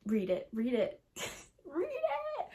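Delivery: playful pleading, last word squeaked high; urging. Acted. Urging her viewers to read the book, playful high squeak. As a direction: insist with a giggle.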